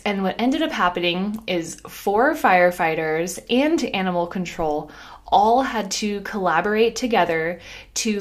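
ordinary delivery

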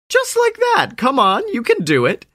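high, sneering show-off voice; smug bully. Acted. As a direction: taunt him with a smirk. Snakeman keeps taunting.

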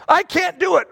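high, emphatic confession; acted helpless admission. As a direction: push it up high as an admission of defeat.

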